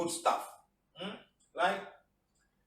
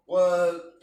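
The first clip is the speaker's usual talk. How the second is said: burst on work; 10 dB louder than usual. Acted. Listing life pressures, the teacher blurts work loudly.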